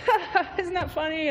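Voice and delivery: high, slow fake laughing; mock laugh. Telling friends a grandpa story, mock laughing high. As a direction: ham up a breezy forced laugh. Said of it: "and I'm like".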